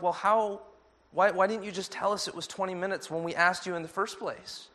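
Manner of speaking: retold protest, voiced high in disbelief; baffled protest. Travellers question the woman who yelled.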